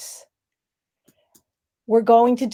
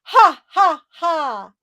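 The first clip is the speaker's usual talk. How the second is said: loud, higher ha ha ha; lung laugh; 5 semitones higher, 6 dB louder, much slower than usual. Shown as a demonstration. Coach leading the group in a ha ha ha laugh. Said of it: "Breathe in".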